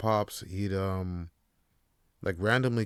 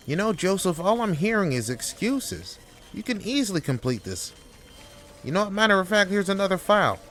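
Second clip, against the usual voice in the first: higher, pushy boss voice; condescending boss talking down to his worker; bossy boss; 11 semitones higher, 4 dB louder than usual. Acted. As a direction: lean on him like a smug manager. Skit boss berating employee.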